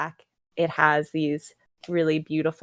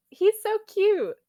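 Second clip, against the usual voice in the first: squealed much higher, louder and slow; gushing; 14 semitones higher, 5 dB louder, slower than usual. Real, not acted. Fan gushing to her co-host about the dragon, squealing. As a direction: melt over it like a puppy video.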